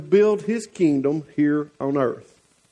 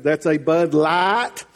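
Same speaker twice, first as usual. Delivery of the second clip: slow Southern drawl, "Light" stretched and rising; Southern accent; slower than usual. Shown as a demonstration. Preacher teasing with his lesson for an English friend, slow Southern accent. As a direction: draw it out Southern, "Light" like "laht". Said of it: "teach him to speak Southern".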